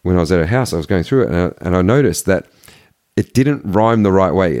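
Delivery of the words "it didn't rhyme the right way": ordinary delivery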